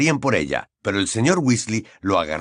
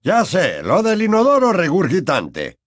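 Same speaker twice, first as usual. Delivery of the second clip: higher, brisk fatherly wizard; eager, slightly raised man's voice; Mr Weasley voice; 7 semitones higher, 4 dB louder than usual. Acted.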